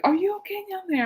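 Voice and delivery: a lightly voiced dad calling down high; dad asking. Dad calling down to his daughter after her fall, mildly concerned. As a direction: call down the stairs, concerned. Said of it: "and he's like".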